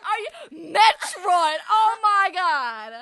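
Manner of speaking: shouting through helpless laughter, high and loud; loud, high, laughing disbelief; hysterical laughter. Real, not acted. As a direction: shriek it while cracking up. Hysterical over the mistaken name.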